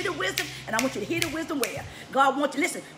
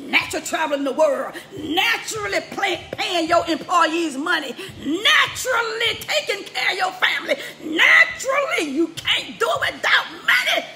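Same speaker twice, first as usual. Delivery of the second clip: building sermon climax; 6 semitones higher, 6 dB louder than usual. Real, not acted.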